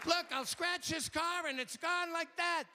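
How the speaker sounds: Billy Mays impression. Dead pitchman still selling in a high shouted voice. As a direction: bellow the pitch back from the dead. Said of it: "who does with the high voice".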